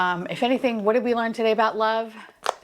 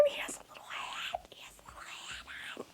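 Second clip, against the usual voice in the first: tiny, fading whisper; adoring whisper; 21 dB quieter, faster than usual. Real, not acted. Collector noticing the figure's little hat, fading adoring whisper. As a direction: whisper it, overcome by the cuteness.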